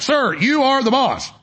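hammed-up aide soothing the boss; mock deferential aide. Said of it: "They're like".

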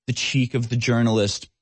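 normal talking voice

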